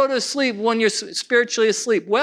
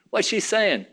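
ordinary talk

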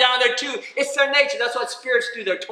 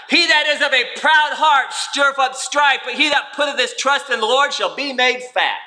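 raised, emphatic scripture read; 5 semitones higher than usual. Acted. Preacher reading Proverbs aloud to the congregation, emphatic. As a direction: proclaim the verse to the church.

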